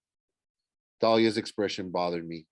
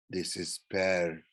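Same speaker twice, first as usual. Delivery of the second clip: lower, softer villain's gloat; 4 semitones lower, 5 dB quieter than usual. A narrator's reading. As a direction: savour it quietly, a touch of gloating. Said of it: "the Manticore gloated".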